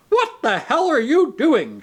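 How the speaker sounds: loud, high furious yell; angry shout. A narrator's reading. Intelligent man shouts at narrator opening window, loud angry. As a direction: bark at him to stop. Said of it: "shouted the intelligent man".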